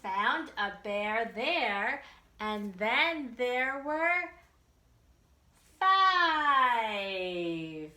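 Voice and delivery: bouncy nursery rhyme read aloud, drawn out before each number; singsong rhyme. Acted. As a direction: chant it for little kids.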